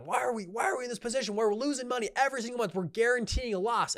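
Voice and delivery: high, rushed mock yell without much volume; imitated investor yelling. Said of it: "his investors are yelling at him".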